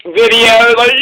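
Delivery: normal talking voice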